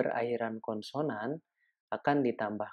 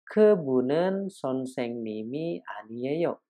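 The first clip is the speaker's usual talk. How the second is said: slow Korean demo; slower than usual. Shown as a demonstration.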